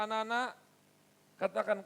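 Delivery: ordinary delivery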